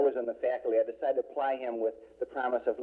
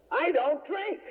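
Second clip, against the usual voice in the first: much higher, imitated protest; indignant father; 14 semitones higher, slower than usual. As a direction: protest as a mock-affronted father. Accused father denies drinking.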